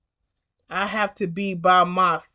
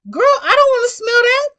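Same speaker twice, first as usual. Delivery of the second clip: much higher, louder disgusted squeal; outraged squeal; 7 dB louder than usual. Retold or imitated. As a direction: shriek your disgust at the girl. Driver outraged at rider's excuse.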